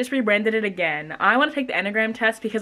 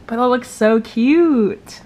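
drawn-out gushing; 3 semitones higher, 7 dB louder, much slower than usual. Real, not acted. Admiring her new header.

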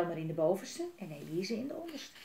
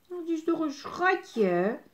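higher, slow, tender coo; 9 semitones higher, 7 dB louder, slower than usual. Real, not acted. Cooing over the cute dragon.

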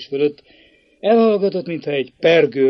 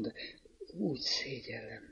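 much softer, trembling words dying away; faltering, fading; 17 dB quieter than usual. A narrator's reading. Girl ashamed before her suitor, faltering and fading. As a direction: let it falter and fade out. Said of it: "rebegte elhalóan".

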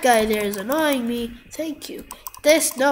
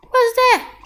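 loud, high startled question; high-pitched baffled yell; alarmed disbelief; 11 semitones higher, 8 dB louder than usual. Real, not acted. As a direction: burst out loud and high at the sight. Alarmed at aliens in the game.

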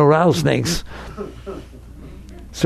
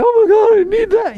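mock clinging. Mocking clinging voice.

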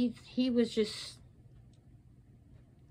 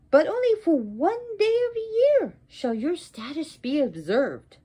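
high proclamation falling at the end; wrathful curse; 5 semitones higher, 3 dB louder than usual. Acted. Sarasvati sentences Brahma to one day of honor, wrathful decree. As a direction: decree it forcefully. Goddess continues her curse.

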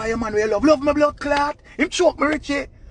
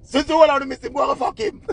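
higher, louder sassy snap; mock yelled retort; 11 semitones higher, 4 dB louder than usual. Vlogger voicing a sassy retort at Spice. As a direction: fling it out like a fed-up clapback. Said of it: "the choke her say".

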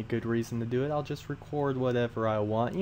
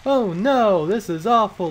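mock alarm; 7 semitones higher, 9 dB louder than usual. Voices the carjacked little couple.

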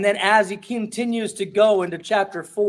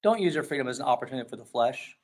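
tempered down; 5 semitones lower than usual. Retold or imitated. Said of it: "he tempers it down again".

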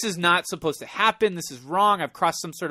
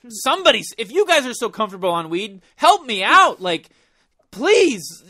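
higher, louder pleading for help; desperate plea; 4 semitones higher, 5 dB louder than usual. Retold or imitated. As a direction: beg them to rescue you. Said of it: "Just awkward, like".

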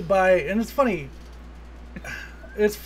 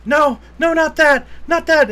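much higher and louder frantic begging; pleading henchman; 10 semitones higher, 8 dB louder than usual. Retold or imitated. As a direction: beg him to stop, voice shooting up. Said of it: "and he goes".

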